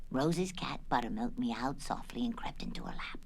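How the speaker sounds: ordinary delivery, nothing marked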